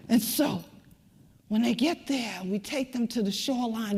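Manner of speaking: ordinary talk, nothing marked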